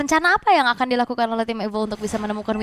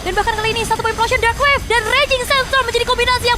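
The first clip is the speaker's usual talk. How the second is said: rising, louder hype call; excited play-by-play; 11 semitones higher, 4 dB louder, faster than usual. Real, not acted. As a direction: build the team fight excitement. Caster hypes team fight.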